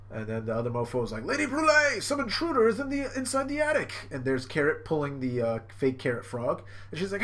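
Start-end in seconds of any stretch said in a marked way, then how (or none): 1.2-3.9 high-pitched urgent cartoon alarm; hammed-up frantic report; alarmed henchman. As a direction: raise the alarm like a panicky minion.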